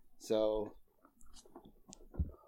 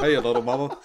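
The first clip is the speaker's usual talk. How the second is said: louder Southern croon with a rising hey; 9 dB louder, faster than usual. Acted. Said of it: "to stay in accent".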